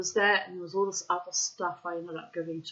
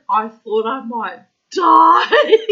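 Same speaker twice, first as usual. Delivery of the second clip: laughing; 4 semitones higher, 15 dB louder, much slower than usual. Real, not acted. Laughing through retold quote.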